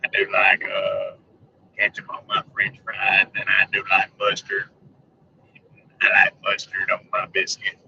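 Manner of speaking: very low Sling Blade rumble; Sling Blade impression. Vlogger riffing on mustard and biscuits, low Sling Blade voice. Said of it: "I fell off in the sling blade hole".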